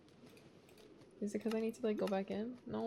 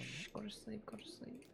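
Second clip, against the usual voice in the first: soft whispered shushing; whispering over a crib; 18 dB quieter than usual. Pretend. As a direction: hush it like lulling a baby. Lulling Pokemon to sleep.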